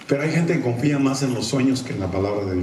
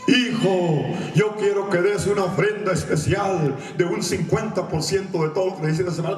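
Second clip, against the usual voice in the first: higher mock divine command; voice from heaven; 7 semitones higher than usual. Fake heavenly voice demanding an offering, higher pitch. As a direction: call out like a pretend God. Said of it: "una voz del cielo".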